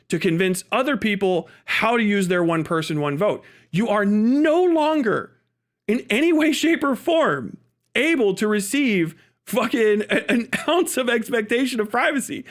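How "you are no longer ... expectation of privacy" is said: heated emphasis. Real, not acted. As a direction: drive it home hard, word by word.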